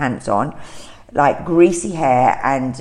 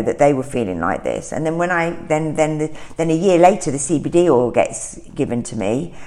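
ordinary delivery

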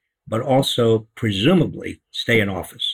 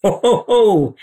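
mock Trump gloating; 6 semitones higher, 4 dB louder than usual. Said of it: "he'd make little jokes about it".

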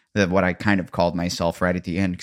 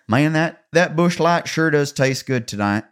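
higher, playful hillbilly twang; hillbilly country accent; 7 semitones higher than usual. Acted. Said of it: "hillbilly country friends".

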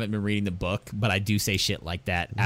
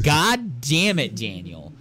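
hammed-up scandalised drawn-out protest; wife mock-scolding her husband, drawn-out and huffy; mock outraged wife; 5 semitones higher, much slower than usual. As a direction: play the wife's exaggerated indignation, slowly. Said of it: "She's like".

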